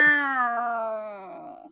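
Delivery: angry cat meow, stretched out. Shown as a demonstration. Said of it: "I can do a really good angry cat voice".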